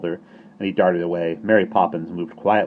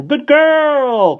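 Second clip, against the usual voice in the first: very high, loud croaked praise; approving croak; 13 dB louder than usual. Acted. Starling praising the baby with a very high loud croak. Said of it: "croaked the starling approvingly".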